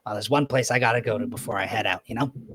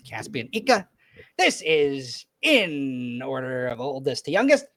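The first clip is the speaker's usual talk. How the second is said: higher, louder proud dad voice; proud introduction; 4 semitones higher, 5 dB louder than usual. Acted. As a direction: present them with a proud flourish. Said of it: "he goes".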